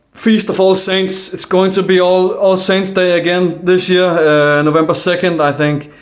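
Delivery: usual speaking voice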